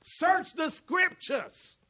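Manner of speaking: slow, loud, high preacher's shout; shouted exhortation. Acted. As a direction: drive the command home to the crowd.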